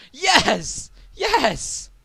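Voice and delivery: high, loud excited yes; excited yelling. Real, not acted. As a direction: cheer it out loud. Excited gamer cheering.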